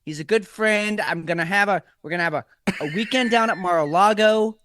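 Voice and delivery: hammed-up Trump bragging and reassuring; mock president promising a Mar-a-Lago weekend; Trump impression. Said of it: "this is President Trump".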